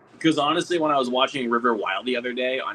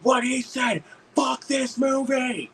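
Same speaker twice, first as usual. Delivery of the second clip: high, stretched shout of agreement; second that heckle in a high, drawn-out holler; mock heckler yell; 13 semitones higher, much slower than usual. Guy in front backing up the heckler, mock yell. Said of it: "same guy that was like".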